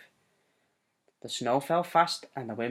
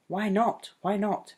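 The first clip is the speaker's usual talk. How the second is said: put-on curious child; 7 semitones higher than usual. Child figure asks eagerly.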